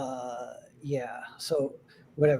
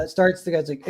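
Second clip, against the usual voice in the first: ordinary talk, nothing marked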